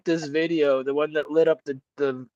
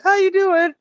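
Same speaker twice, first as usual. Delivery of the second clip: sugary high how you doing; high friendly greeting; 4 dB louder than usual. Retold or imitated. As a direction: lift it sweetly into falsetto. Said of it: "You were like".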